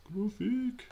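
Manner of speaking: high, loud silly echo of GRAPHIC; playful put-on voice. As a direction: squeal GRAPHIC in a comic voice.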